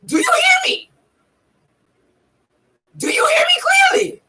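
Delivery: very high, strained creature cry; Yoda. Acted. Checking that viewers hear the teaching, high Yoda voice. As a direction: push each question out in a squeezed alien voice. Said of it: "I'm using my Yoda voice".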